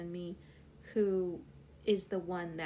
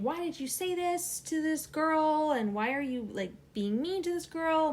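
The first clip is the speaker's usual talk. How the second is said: higher-pitched mock scolding; retold yelling; 7 semitones higher, 4 dB louder than usual. Older sister accusing me of being mean, mock yelling. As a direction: imitate an angry telling-off without full shouting. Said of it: "yelling at me".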